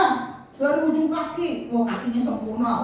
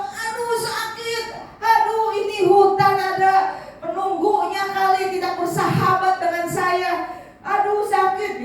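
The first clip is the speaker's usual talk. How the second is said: much higher, wailing ouch and grumbling; whining complaint; 7 semitones higher than usual. Acted. King stung by a wasp, whining in high complaint. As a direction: squeal and grumble at the stinging wasp. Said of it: "Dia ngomel-ngomel gitu".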